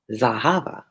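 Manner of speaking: Zahava with a stressed HA and rising end; stress on middle syllable. Shown as a demonstration. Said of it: "so many places I hit the accent".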